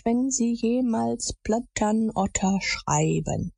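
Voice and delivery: slow, drawn-out chant with swooping pitch; solemn oath reading. A narrator's reading. Boys' written oath read aloud, slow and solemn.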